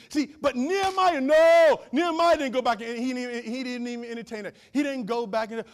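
normal talking voice

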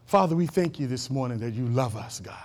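soft, low prayer voice; closing prayer. Acted. Pastor leads the congregation in a soft, low prayer. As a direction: pray quietly over the bowed heads.

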